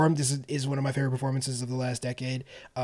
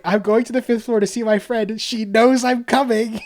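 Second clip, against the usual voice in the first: put-on higher, louder little old lady voice, slowing at the end; imitating an old woman's prim reply, higher and louder; mock old woman; 8 semitones higher, 6 dB louder than usual. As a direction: play the frightened old woman in the elevator, hammed up. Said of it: "She was like".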